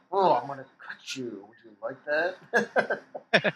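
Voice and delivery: slow, drawn-out creepy taunt; a creepy slasher teasing his victim; creepy killer voice. Pretend. Voicing the killer's creepy line.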